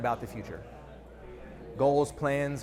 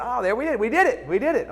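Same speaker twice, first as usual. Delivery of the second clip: higher, laughing mock celebration; playful pretend victory, higher and laughing; mock triumph; 6 semitones higher, 3 dB louder than usual. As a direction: cheer the breakthrough with a laugh. Acted breakthrough in mock dialogue.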